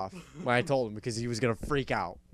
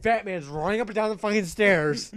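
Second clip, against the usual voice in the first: ordinary talk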